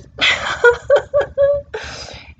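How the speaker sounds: a high laugh that tips into crying; laugh breaking into a cry. Real, not acted. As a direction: laugh through rising tears. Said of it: "Here come the tears".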